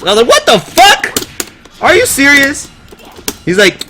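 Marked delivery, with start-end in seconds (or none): 0.3-2.7 high-pitched, drawn-out disbelief; hammed-up high incredulous reaction. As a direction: squeal it in mock outrage. Retelling his disbelief at friend's verdict.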